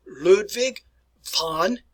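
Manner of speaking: "Ludwig" with W as V, "von" with an F; "Ludwig von" carefully modelled; German pronunciation. Shown as a demonstration. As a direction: say "Ludwig von" as "Lood-vig fon". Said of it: "you probably should pronounce it properly".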